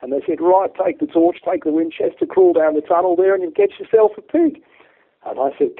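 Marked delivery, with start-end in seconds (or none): 0.4-4.6 loud, high Aussie instructions; imitated Aussie lad egging someone on; Australian accent. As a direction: order it in a put-on Australian voice. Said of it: "kill me for this Australian accent".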